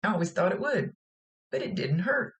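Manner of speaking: lightly imitated amazement; mildly amazed. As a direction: voice the girl, amazed at it. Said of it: "she's kind of amazed by it".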